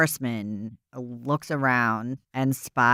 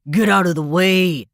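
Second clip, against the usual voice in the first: loud, higher angry shout; 6 semitones higher, 9 dB louder than usual. Retold or imitated. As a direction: shove through and yell it. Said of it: "He's like".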